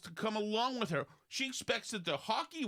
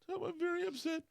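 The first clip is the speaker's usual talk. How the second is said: much higher, put-on girlish voice; imitating girlfriend; 12 semitones higher than usual. Quoting the girlfriend's upset phone call, high and mock. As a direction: play her as the distressed girlfriend. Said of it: "and she said".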